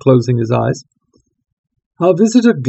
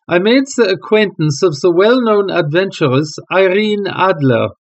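solemn king; 5 semitones higher than usual. Acted. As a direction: begin the confession gravely. King tells his story.

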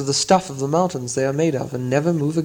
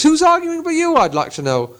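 indignant troll; 14 semitones higher than usual. Acted. Tom blaming William, high indignant troll. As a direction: bristle at the accusation. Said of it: "said Tom".

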